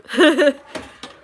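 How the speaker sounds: loud, high burst of laughter. Real, not acted. Laughs at own failed accent.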